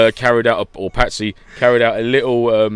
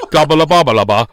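mock Belfast babble; 4 semitones higher, 6 dB louder than usual. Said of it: "hard to say in a Belfast accent".

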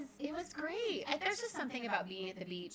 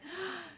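delighted gasp; 10 semitones higher than usual. Retold or imitated. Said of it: "And I was like".

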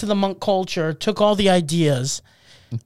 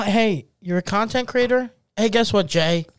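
ordinary talk

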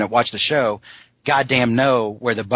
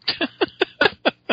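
high squealing laugh. Real, not acted. Laughing at his own impression.

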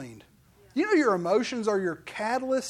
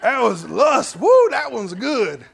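high, loud whooping with a laugh; hammed-up loud hollering with laughter; whooping Ray; 3 semitones higher, 11 dB louder than usual. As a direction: whoop it up like a rowdy guy. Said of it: "Ray was like".

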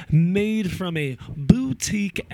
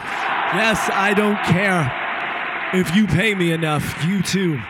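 defiant shout; 3 semitones higher, 4 dB louder than usual. Real, not acted. Defiant to viewers.

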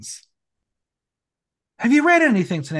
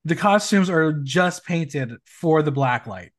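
normal talking voice

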